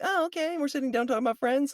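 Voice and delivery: high, squeaky put-on voice of a duped star; voicing a tricked actress in a high squeak; mock realization. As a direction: act the dawning of a prank in a falsetto. Voicing Aniston realizing the prank.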